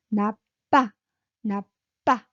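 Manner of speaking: 나빠 with a loud, tense 빠; high-pitched 나빠. Shown as a demonstration. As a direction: stress the tense 빠 in 나빠. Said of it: "high pitch".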